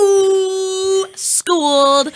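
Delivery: high, loud teasing ooh; impressed ooh. Real, not acted. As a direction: tease like a burn landed. Reacting to a savage reply.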